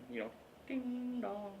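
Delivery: slow sing-song ding dong; ding dong bell. Pretend. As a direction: chime it like a doorbell. Imitating bell sound.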